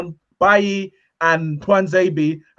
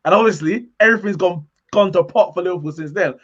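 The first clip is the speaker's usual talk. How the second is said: ordinary delivery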